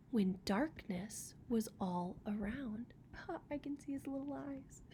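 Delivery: breathy storybook whisper; hushed whisper. A narrator's reading. Reading to a child about the dark, whispering. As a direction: whisper it softly, like a secret in the dark.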